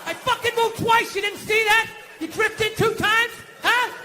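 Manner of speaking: high, loud New York tough-guy outrage; Dustin Hoffman, De Niro. Acted. [name] yells at the woman who bumped him, indignant movie tough-guy shout. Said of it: "old school Dustin Hoffman, De Niro, like".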